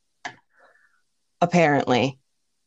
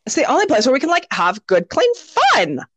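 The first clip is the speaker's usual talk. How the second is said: put-on high squeaky Valley Girl; 5 semitones higher than usual. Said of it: "she does her Valley Girl squeaky voice".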